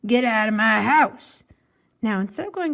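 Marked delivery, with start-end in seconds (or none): 0.1-1.5 loud angry shout; storybook neighbor's shout; mean neighbor yelling. Acted. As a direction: yell it to chase the wire away. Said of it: "mean and yelled".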